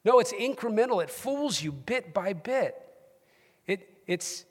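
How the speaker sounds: urgent, rising preacher voice; fervent preaching. Real, not acted. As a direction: drive the warning home to the church. Preaching at full force.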